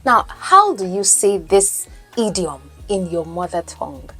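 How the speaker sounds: usual speaking voice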